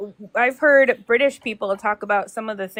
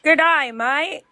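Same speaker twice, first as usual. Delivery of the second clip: slow, higher Australian greeting; Australian accent; 5 semitones higher, slower than usual. Shown as a demonstration. Pupil trying a phrase just taught, Australian accent. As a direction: try out an Australian "g'day, mate". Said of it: "You sound so Australian".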